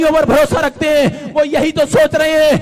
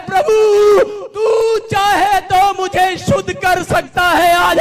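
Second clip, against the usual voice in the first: tearful plea; 8 semitones higher, 4 dB louder, slower than usual. Acted. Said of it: "यीशु को देखते ही रो पड़ा".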